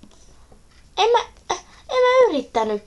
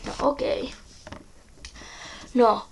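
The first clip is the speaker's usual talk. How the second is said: ordinary talk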